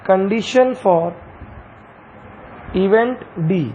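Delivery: very slow, word by word dictation. Shown as a demonstration. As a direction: dictate each word slowly for notes. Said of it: "dictating slowly to students". (बहुत धीरे, शब्द-शब्द लिखवाना)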